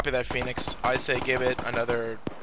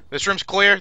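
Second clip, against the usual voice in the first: shouting; 8 semitones higher, 6 dB louder than usual. Real, not acted. Calling room clear.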